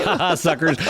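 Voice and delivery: joking gloat with a laugh; mock gloating. Host pretending the free call is a scam, mock gloating. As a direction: laugh mockingly at the suckers you fooled. Jokes the call is a money-maker.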